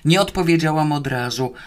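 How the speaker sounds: ordinary delivery, nothing marked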